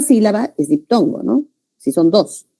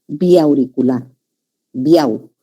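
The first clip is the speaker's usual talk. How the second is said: slow syllable demo; 3 semitones lower, much slower than usual. Shown as a demonstration. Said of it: "syllable demo".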